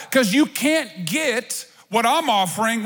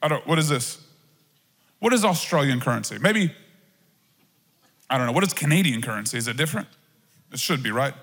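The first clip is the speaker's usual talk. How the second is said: much lower, puzzled barista voice; Bruce the confused barista; 8 semitones lower, faster than usual. Retold or imitated. Barista handed foreign money, answering in a low, puzzled voice. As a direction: play a baffled cashier staring at foreign money. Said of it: "Bruce would be like".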